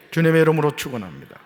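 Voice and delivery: 주님의 이름으로 축원합니다 loud and fast; ringing proclamation. Real, not acted.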